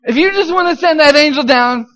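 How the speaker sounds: loud, high plea to heaven; frantic bargaining prayer. Retold or imitated. Officer's captive bargaining with God for a way out, frantic. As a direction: plead urgently and loudly, talking God into it.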